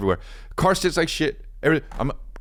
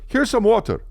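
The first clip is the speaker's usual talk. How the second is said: mock helpful stranger; 11 semitones higher than usual. Helper voiced in retold story.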